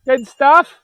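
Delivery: good stuff in a high, loud, rising voice. Real, not acted. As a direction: exclaim it with glee. Delight at animals on pasture.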